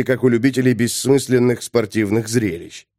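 ordinary delivery, nothing marked

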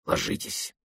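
urgent whispered order, very soft; hissed whisper. A narrator's reading. Man warns woman at a checkpoint, hissed whisper. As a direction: hiss it under your breath. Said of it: "прошипел один из мужчин".

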